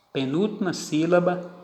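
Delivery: ordinary delivery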